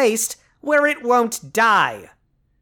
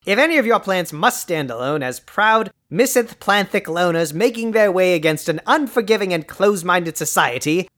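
ordinary delivery

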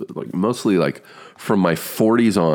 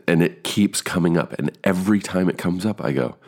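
normal talking voice

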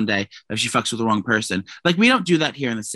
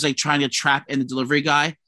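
ordinary talk

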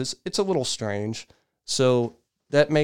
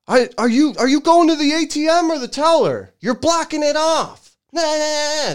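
much higher and louder mock yell; hammed-up shrill tantrum, much higher and louder; imitating a screaming man; 7 dB louder than usual. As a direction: mimic the old man shrieking at the driver. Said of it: "Starts screaming at the guy".

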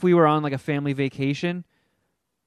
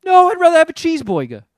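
mock dad voice; 13 semitones higher, 10 dB louder, faster than usual. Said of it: "he's like".

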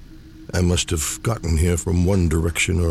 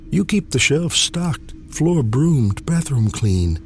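higher, buttering him up; eager flattery; 6 semitones higher, slower than usual. Retold or imitated. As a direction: sell the compliment a bit too hard. Speaker flatters dead father.

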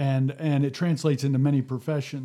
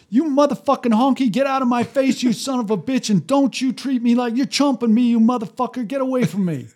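retold furious outburst, much higher and louder; furious insult tirade; 8 semitones higher, 5 dB louder than usual. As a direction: hurl the abuse like the angry patient at the door. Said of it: "the response was".

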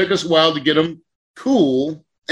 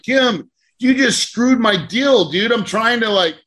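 exasperated scolding; 6 semitones higher than usual. Retold or imitated. Scolding friend over leak.

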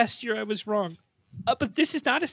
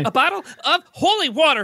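ordinary talk

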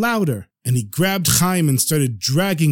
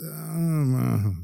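put-on drowsy drunken mumble; 6 dB quieter than usual. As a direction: mumble half asleep, drunk. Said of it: "Chaim was so drunk".

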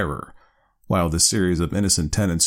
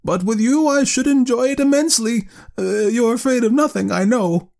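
high, louder eager insistence; raised voice; 4 dB louder than usual. A narrator's reading. Aunt urging her nephew along, voice raised. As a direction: raise your voice, pitched high. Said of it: "raising her voice".

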